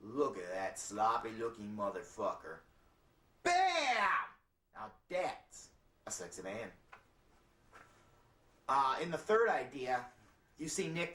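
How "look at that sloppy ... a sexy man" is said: low ad voice with a sudden "Bam!"; commercial voiceover. Acted. Voiceover for the sloppy-to-tux switch, low announcer. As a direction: drop it deep for a mock TV spot. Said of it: "The voiceover for that was to read".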